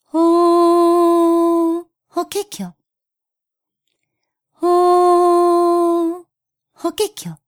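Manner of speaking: high, sing-song bird call; warbler call. Acted. Bush warbler sings its call.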